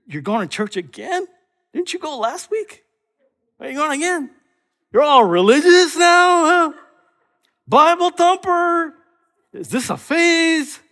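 high-pitched, incredulous mocking voice; skeptical grumblers. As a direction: needle with rising, disbelieving questions. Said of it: "do you have people around you that, that go".

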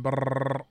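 rapid rolled brrr like gunfire; machine gun noise. Shown as a demonstration. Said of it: "think of it more like a machine gun".

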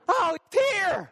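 high, yelped greeting with stretched words; overexcited dog. Acted. Dog greeting its owner coming home, overexcited. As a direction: burst out like a dog at the door. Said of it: "your dog is all over you".

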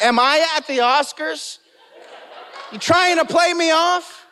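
laughing mock complaint, pitched high; mock protest. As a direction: tease the musicians playing him off. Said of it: "and I'm like".

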